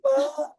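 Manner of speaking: high mock cry of pain; hammed-up little-sister wail. Sister wails after being tripped, high hammed-up cry.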